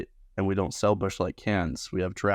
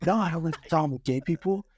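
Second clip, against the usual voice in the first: mock defensive customer; 9 semitones higher than usual.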